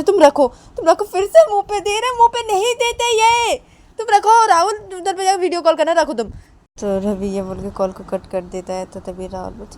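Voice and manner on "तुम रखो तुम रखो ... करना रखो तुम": high, loud scolding voice. Acted. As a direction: scold her for letting the baby mouth it. Father scolds over phone about child. (Phone पर पत्नी को ऊँची आवाज़ में डाँटते हुए)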